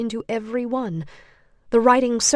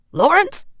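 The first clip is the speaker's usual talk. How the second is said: much higher, louder shout rising; shouted name; 9 semitones higher, 8 dB louder than usual. A narrator's reading. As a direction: bellow his name again. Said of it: "the man shouted again".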